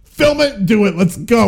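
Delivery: loud, high-pitched director barking orders; hammed-up hurry-up director; Gary Marshall. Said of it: "So Gary Marshall was just".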